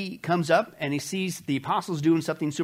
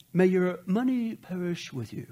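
gently voiced polite curse, a touch higher; mock mild blessing; 3 semitones higher than usual. Mock mild wish from the apostles to the sorcerer. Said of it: "because I'm sure that's how they said it".

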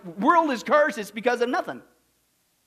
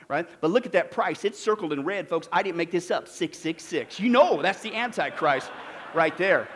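ordinary talk, nothing marked

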